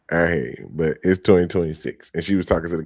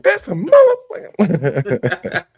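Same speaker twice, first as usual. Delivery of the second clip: high, slow, laughing through it; cracking up mid-quote; laughing mock voice; 13 semitones higher, 5 dB louder, much slower than usual. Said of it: "He was like".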